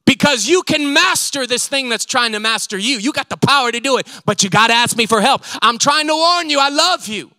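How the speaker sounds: loud, high, pressing sermon voice; full-force preaching. Acted. Preacher urging the congregation to ask God for help, loud and pressing. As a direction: drive the warning home with love.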